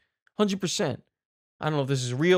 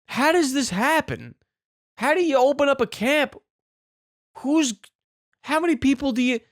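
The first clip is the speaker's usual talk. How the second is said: incredulous rant; 7 semitones higher than usual. Real, not acted. Baffled, exasperated rant about the camp.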